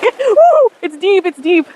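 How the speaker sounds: high thrilled woo in cold water; excited squeal. Real, not acted. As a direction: squeal with delight wading in. Wading into deep water.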